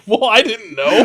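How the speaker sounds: high, loud mock whine; hammed-up self-defence; defensive protest. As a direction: act out an indignant excuse. Acted quarrel, defensive reply.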